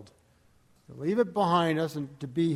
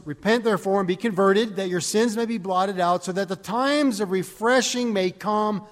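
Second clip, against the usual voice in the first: louder, measured reading of the verse; scripture reading; 5 semitones higher, 5 dB louder than usual. Acted. Preacher reading Philippians aloud to the congregation, declaiming. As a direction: proclaim the text to the congregation.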